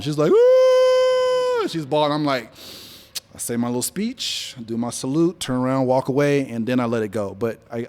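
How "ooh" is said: high, loud mock wail; imitated wailing sob. Widow at the funeral bawling, imitated wail. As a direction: mimic a widow's bawling cry.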